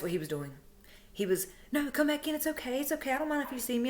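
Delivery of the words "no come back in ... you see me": high-pitched coaxing, lightly imitated; high, wheedling imitation of reassurance; mock reassurance. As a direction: retell someone insistently smoothing it over. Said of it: "try to assure you that everything is okay".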